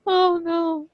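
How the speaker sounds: mock lament. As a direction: play up being the boring ones. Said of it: "we're over here just like".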